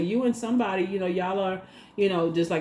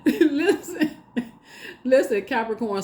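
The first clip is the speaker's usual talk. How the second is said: laughing; 7 semitones higher, slower than usual. Real, not acted. Laughing call out.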